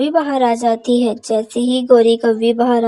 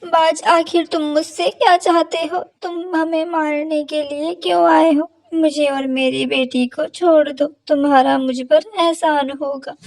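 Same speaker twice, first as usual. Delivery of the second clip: higher pleading voice; begging mother crow; 4 semitones higher than usual. Acted. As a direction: bargain desperately with the hawk. Mother crow begs hawk. (ऊँची आवाज़ में विनती करती माँ)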